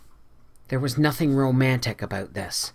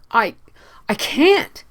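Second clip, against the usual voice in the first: high, broken, miserable stammer; miserable, much higher, halting; 9 semitones higher, 6 dB louder, slower than usual. A narrator's reading. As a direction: falter out the refusal. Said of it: "said Anne miserably".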